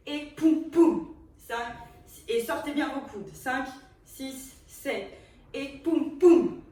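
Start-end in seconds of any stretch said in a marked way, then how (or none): 0.1-1.1 loud, sharp poum poum; the dance teacher calls the hit loudly to the class; punchy beat call. Shown as a demonstration. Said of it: "Bien net les mouvements". 5.5-6.6 punchy beat call. Shown as a demonstration. Teacher calling the hit.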